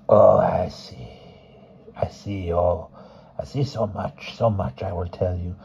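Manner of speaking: put-on hushed old woman voice. Fortune teller reads palm.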